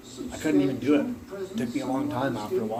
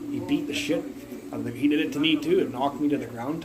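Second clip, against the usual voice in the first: usual speaking voice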